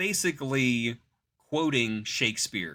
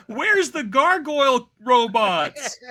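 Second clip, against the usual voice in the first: high, loud, slow whine; whining kid; 12 semitones higher, 9 dB louder, slower than usual.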